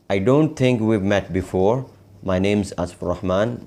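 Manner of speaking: connected phrases, words linked; whole-sentence model. Shown as a demonstration. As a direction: run the practice sentence through without word gaps. Same sentence repeated as a whole.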